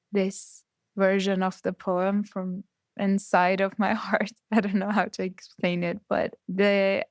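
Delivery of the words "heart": ordinary talk, nothing marked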